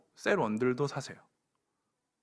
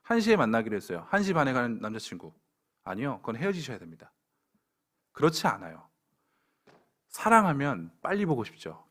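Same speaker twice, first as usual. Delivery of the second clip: usual speaking voice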